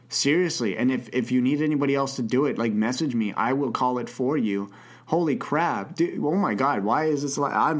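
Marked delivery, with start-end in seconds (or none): none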